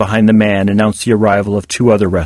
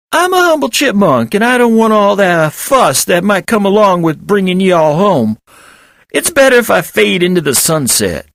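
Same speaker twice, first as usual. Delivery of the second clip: humble chipmunk voice; 11 semitones higher than usual. A narrator's reading. Chipmunk character voice.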